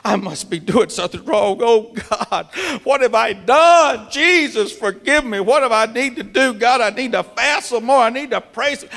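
high, pleading cry to God; anguished self-blaming prayer. Retold or imitated. Preacher acting out a desperate prayer, pleading.

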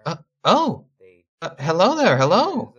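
high, startled woman's voice; high surprised hello from a sweeping woman; flustered innkeeper woman. Acted. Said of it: "and goes".

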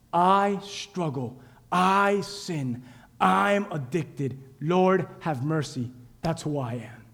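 soft, slow, humble prayer; slow hushed confession. Acted. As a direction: confess quietly, one word at a time.

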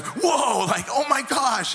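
high, loud startled cry; shocked exclamation. Retold or imitated. Jacob waking to find Leah, a loud startled whoa. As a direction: jolt up in shock at the sight.

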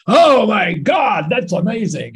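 loud, high, drawn-out mock shout; excited Aussie bloke. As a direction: holler it like a thrilled Aussie bloke. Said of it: "An Aussie bloke would get very excited by that news".

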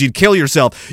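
ordinary talk, nothing marked

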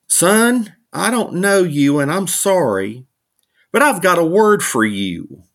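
lightly voiced old stranger; old man voice. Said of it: "this old fella taps me on the shoulder".

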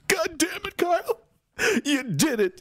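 high, cracking cry of joy with a laugh; ecstatic cheer. Retold or imitated. Watching the verdict live, cheering at Kyle, high and tearful. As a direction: relive the moment the verdict hit, half crying. Retold cheering in his car.

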